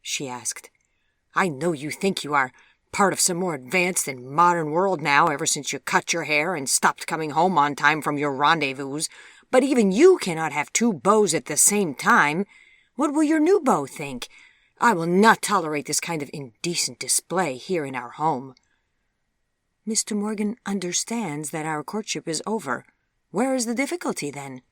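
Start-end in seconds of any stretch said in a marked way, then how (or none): 1.3-18.6 scolding mother. A narrator's reading. Mother scolding her daughter over two beaux, indignant. 19.8-24.6 pointed retort, jumping higher on the question. A narrator's reading. Daughter answering her mother's scolding, retorting. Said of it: "retorted Vivian".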